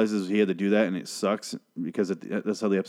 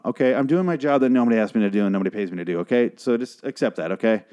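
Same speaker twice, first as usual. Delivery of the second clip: fast, punchy rant; quick defensive rant; rapid rant. Real, not acted. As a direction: rattle it off like a rap verse. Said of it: "I feel like I just rapped".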